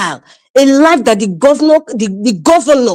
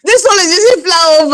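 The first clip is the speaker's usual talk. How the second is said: high laughing mockery; 6 semitones higher than usual. Real, not acted. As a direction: laugh it out in disbelief. Laughing at flyover project.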